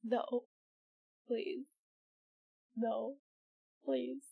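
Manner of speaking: weak whispered-down begging; faint pleading. Acted. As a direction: beg with almost no voice left. Overpowered character begging weakly.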